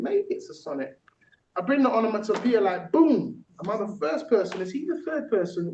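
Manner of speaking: lifted, rhythmic rap-style delivery; poem performance. Acted. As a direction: perform it playfully like a rapper. Performs own technique poem.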